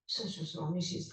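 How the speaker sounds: hushed whisper to the guru; mother whispering an excuse. Retold or imitated. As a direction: whisper so the child can't hear.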